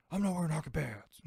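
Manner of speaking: weird forced stage-whisper superhero; Batman. Retold or imitated. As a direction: rasp it out in a bad superhero whisper. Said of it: "a weird whisper".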